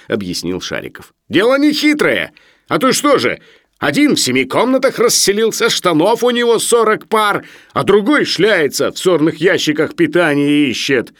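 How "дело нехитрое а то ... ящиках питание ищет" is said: high, loud, tipsy holding forth; chatty after vodka. A narrator's reading. Sharikov explains sharing to the professor, tipsily garrulous. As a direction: press the grievance like a loudmouth. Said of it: "становясь словоохотливым после водки".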